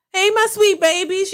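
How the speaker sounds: Monique's greeting pitched well up high. Shown as a demonstration. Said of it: "not high pitch".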